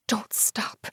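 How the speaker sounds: urgent breathless whisper. Acted. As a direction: hiss it under your breath. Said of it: "Don't stop, Ella! I yelled".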